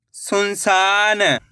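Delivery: slow, drawn-out blending of the word; slow clear reading. Shown as a demonstration. Teacher to pupils, blending the word slowly. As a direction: stretch each syllable for the pupils. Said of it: "एक एक अक्षर का उच्चारण सही से करना".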